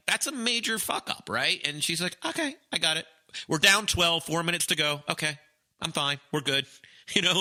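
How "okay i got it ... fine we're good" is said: confident calm. Retold or imitated. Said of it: "just the sheer confidence through Zen".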